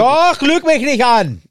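angry shout as Ron. Acted. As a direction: yell it at her as Ron. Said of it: "Ron sagt das".

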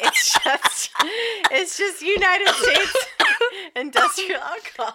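high-pitched giggling through words; can't stop laughing mid-sentence. Real, not acted. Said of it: "Why am I doing that?".

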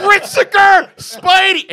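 starstruck shout. Retold or imitated. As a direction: yell it like a kid seeing a hero. Retold fan outburst at hero.

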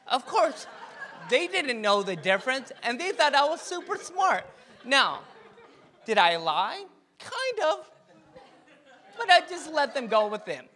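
high, laughing through the punchline; laughing confession. Real, not acted. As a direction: laugh at your own little lie. Laughing at own BC trick.

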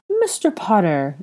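cheerful, stretched-out hello; delighted greeting. A narrator's reading. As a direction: hail him with gushing delight. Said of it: "a man greeted with delight".

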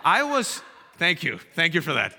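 ordinary talk, nothing marked

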